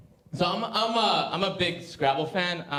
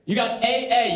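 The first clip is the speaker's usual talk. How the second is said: ordinary talk, nothing marked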